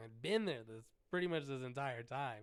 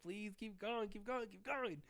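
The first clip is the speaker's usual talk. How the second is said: high, eager begging; pleading; excited pleading; 8 semitones higher than usual. Retold or imitated. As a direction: urge him on, thrilled. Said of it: "I got excited".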